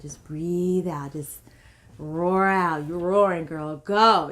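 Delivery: loud, building cheer, stretched words; rousing birth coach. Retold or imitated. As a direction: imitate a midwife urging her on. Midwife urging her to roar.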